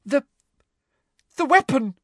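put-on high, halting girl; falter, then give it up; feigned frightened confession; tearful hesitation. Hermione feigning tears under questioning.

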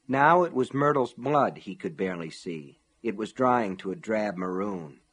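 plain reading voice

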